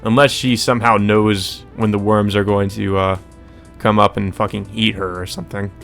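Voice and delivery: ordinary talk, nothing marked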